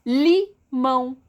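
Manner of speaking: "Limão" slow and loud, split as Li, mão; syllable by syllable. Shown as a demonstration. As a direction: break "Limão" into two firm beats with a pause. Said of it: "Duas sílabas também".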